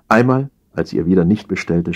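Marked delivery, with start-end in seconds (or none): none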